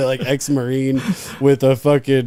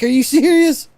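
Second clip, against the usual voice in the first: high, loud mock outrage; disbelief; 6 dB louder than usual. Driver outraged at the guard, high and loud. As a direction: squeal it in disbelief. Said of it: "And I'm like".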